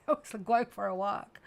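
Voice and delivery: amused, words bubbling out in laughter; laughing. Real, not acted. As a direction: start the story through a real laugh. Laughs while starting an anecdote.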